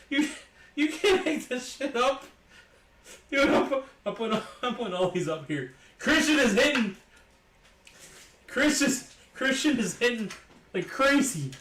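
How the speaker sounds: high, loud, breaking into laughs; crying with laughter. Real, not acted. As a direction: gasp out words amid a laughing fit. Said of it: "I'm crying".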